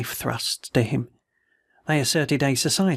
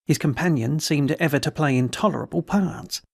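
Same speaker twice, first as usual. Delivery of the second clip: ordinary delivery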